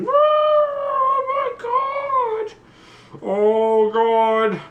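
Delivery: very high, drawn-out strained shriek; shrieking plant. Acted. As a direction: scream it like a mutant plant in agony. Mutated plant shrieking in the guardhouse.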